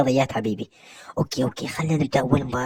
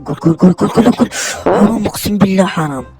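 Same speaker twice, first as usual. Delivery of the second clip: louder, much higher yell sliding into anguish; goal shout into dismay; 8 semitones higher, 12 dB louder than usual. Real, not acted. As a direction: scream goal then despair at the miss. Near-goal then miss. (صراخ هدف ثم حسرة)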